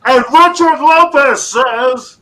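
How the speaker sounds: loud, slow announcer holler; all-caps shout. Shown as a demonstration. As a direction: belt it out as if in capitals. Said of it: "you're supposed to say".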